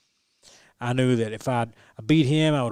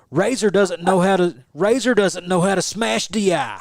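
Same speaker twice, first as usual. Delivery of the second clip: higher, louder trash-talk commentator voice; mocking commentator taunt; 7 semitones higher, 6 dB louder than usual. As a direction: jeer like a heckling commentator. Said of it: "he's just crap talking me".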